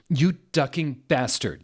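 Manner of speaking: a touch of a mock yell at a rival; half-hearted shouted insult; mild yell. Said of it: "yelled Draco at Vampire".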